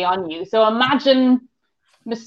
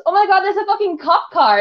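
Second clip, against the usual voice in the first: alarmed housemate; 9 semitones higher than usual. Retold or imitated. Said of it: "she goes".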